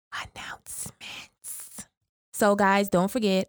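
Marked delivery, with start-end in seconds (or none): 0.1-1.9 hushed whisper. Pretend. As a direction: whisper it like a secret. Whispered teaser of announcements.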